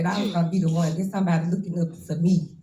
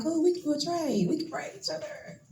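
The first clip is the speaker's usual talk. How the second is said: excited reply; 10 semitones higher, 8 dB quieter than usual. Retold or imitated. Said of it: "I'm like".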